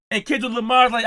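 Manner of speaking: normal talking voice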